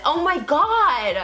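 slow shocked gasp; drawn-out oh my God. Real, not acted. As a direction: stretch it out in disbelief. Reacting to tattoo.

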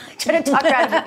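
laughing. Real, not acted. Laughing at her own attempt.

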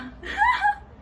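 shrill burst of giggling up high; real giggle fit; high squealing laugh. Real, not acted. Laughing at sunglasses joke.

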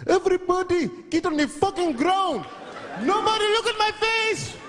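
screaming hijacker with mock Chinese accent. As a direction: bark orders as a hijacker in a put-on accent. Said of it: "Why is he talking like that".

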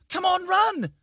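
put-on very high, loud child's squeal; childlike squeal. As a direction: squeal it like a panicked kid. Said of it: "A childlike voice squealed".

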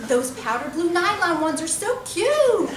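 high, slow, dainty mockery; squeaky prissy homeowner; high-pitched voice. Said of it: "in a high-pitched voice".

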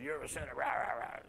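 hushed, throwaway parody of a ranting preacher; mock yelling preacher. Mimicking preachers who yell at sinners, mock yelling. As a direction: rattle it off as empty, growled-under ranting. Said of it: "no screaming, no yelling".

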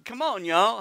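Oklahoma twang. Shown as a demonstration. Preacher trying out a folksy phrase, Oklahoma twang. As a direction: lean into an Oklahoma accent. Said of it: "I know how to talk Oklahoman".

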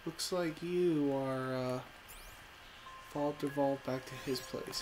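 ordinary delivery

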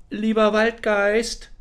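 high, slow call into the forest. Acted. Lost girl calls the forest spirit for help, high call. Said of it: "rief sie".

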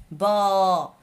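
با said loud and held as a long vowel; teacher demonstrating the long a; sounding out a syllable. Shown as a demonstration. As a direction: sound out با with a stretched a. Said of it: "إلا كان عنده مد بالألف".

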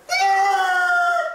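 high loud yelp; startled scream. Acted. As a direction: yelp as the tire hits. Near miss in car.

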